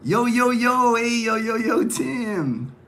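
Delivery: big excited hello to a friend; excited greeting. Real, not acted. Hyped call-out greeting viewer Tim in live chat.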